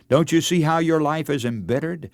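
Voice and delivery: usual speaking voice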